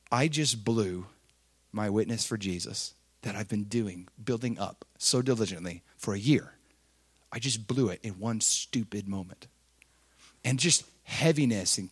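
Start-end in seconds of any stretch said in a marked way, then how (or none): 0.1-9.5 low, soft and defeated, talking to himself; crushed inner voice. Retold or imitated. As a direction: let the shame sink the words down. Realizes he ruined his witness.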